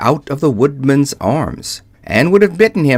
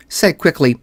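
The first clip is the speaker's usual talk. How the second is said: ordinary delivery, nothing marked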